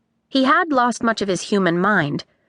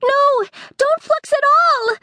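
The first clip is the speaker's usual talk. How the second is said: high urgent shout; Pepper shouting; 3 dB louder than usual. A narrator's reading. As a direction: shout to stop them. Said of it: "shouted Pepper".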